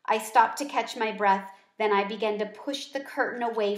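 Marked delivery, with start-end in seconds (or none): none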